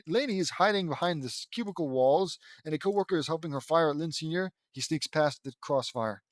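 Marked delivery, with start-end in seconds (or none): none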